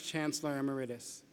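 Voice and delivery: ordinary talk, nothing marked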